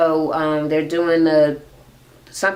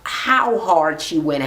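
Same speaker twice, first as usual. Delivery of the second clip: ordinary delivery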